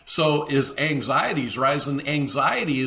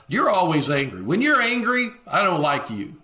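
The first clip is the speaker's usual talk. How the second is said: mock accusing; 5 semitones higher, 3 dB louder than usual. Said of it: "aggressive statements are".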